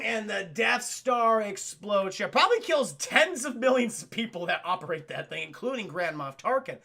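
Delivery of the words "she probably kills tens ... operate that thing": ordinary talk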